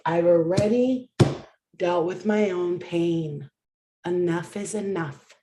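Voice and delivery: slow, low, deliberate insistence. Real, not acted. Slowing down to insist.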